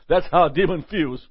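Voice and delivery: normal talking voice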